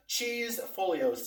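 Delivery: Cheese Folios spelt out slowly, every syllable drawn long; carefully drawn-out "Cheese Folios" for the viewers; slow and clear. Shown as a demonstration. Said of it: "C H E E S E F O L I O S".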